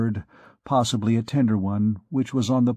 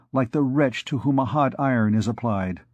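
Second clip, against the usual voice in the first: usual speaking voice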